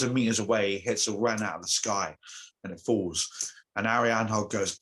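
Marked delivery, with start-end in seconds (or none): none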